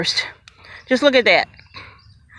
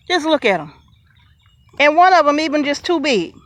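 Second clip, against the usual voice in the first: ordinary delivery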